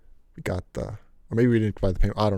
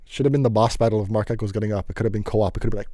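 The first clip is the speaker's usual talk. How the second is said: normal talking voice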